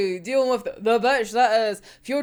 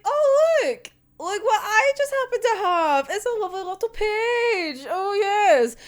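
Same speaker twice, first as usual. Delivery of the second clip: mock sing-song voice, higher and showy; gloating Fyodor; 6 semitones higher than usual. Fyodor taunting Atsushi with the page, in a mock gloating higher voice. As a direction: ham up a smug villain showing off his prize. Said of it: "it's just like".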